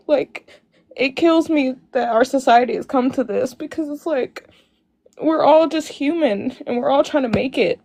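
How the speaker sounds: words pushed out through crying. Real, not acted. Telling the host she forgives everyone, crying. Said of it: "come on your live crying".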